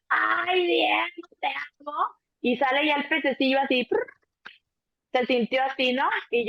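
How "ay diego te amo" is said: higher, whispered, fading away; whispered labor plea. Retold or imitated. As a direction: breathe it out mid-contraction. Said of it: "ahí viene la otra contracción". (susurro agudo en plena contracción)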